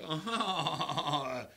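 a startled laughing oh; surprised laugh. Retold or imitated. Friendly surprised laugh at the stranger. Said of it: "I go".

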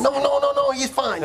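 high, urgent denial; panicked protest. Acted. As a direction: rush to insist all is well. Protesting dog's removal.

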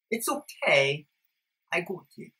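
put-on English accent, softly said. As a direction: play at being English. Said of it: "this is my, my English accent".

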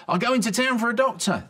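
high, loud panicked promise; urgent cry. Acted. Camper rushing off to fetch a doctor, panicked. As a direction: shout it in a rush to get help.

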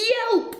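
very high, loud yelp; high startled yelp. A narrator's reading. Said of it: "each let out a".

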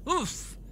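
sharp high grunt of impact; knocked breathless, grunt it out. A narrator's reading. Slammed into a wall, Dlik grunts in pain. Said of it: "he grunted".

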